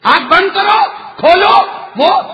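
full-voiced preaching. Preacher telling a congregation the Sulaiman story, full-voiced preaching. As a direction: tell it loudly to a congregation.

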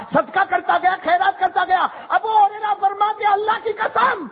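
high rising preached cry; a preacher swearing an oath aloud before the congregation; full-force preaching. Acted. Sermon climax rises again.

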